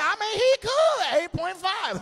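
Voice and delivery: high, airy impression of her; a high mock of her rating him. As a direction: play her as cool and unbothered. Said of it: "She be like".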